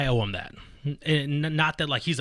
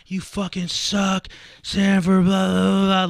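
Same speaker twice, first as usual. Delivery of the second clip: slow, higher and much louder mock heckle; mock drunk heckling; 7 semitones higher, 8 dB louder, slower than usual. Recalling himself heckling his opponent from the crowd, mock drunk heckling. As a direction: imitate your old self heckling from the back. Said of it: "hear me in the back saying all this stuff".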